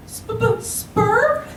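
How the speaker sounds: very high, squeaky stammer; stuttering. Acted. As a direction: stutter out the word in a tiny high voice. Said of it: "to stutter out the single syllable".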